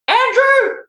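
high falsetto mum calling dinner. Retold or imitated. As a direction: yell like mum from the kitchen. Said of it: "your mum's yelling in the background".

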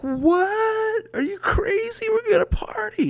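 squeaky high, hammed-up disbelief; mock falsetto party outrage. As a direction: shriek it like a scandalized friend. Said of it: "we're like".

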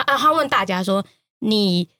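ordinary talk

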